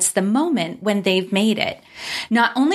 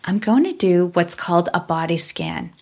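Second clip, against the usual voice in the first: calm meditative tone; 3 semitones lower than usual. Acted. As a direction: ease them into stillness, unhurried. Leads a guided body scan.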